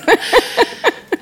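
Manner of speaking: short high-pitched burst of real laughter; a bright amused laugh. Real, not acted. Guest laughing at a teasing remark about her accent, high laugh.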